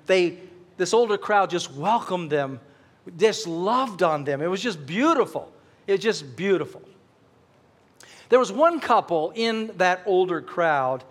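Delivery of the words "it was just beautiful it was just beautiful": usual speaking voice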